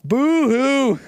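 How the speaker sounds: high mocking boo-hoo; mock crying. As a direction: sneer a fake little sob. Mocking chat's reaction.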